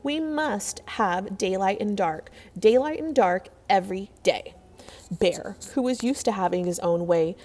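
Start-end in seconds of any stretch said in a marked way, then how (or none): none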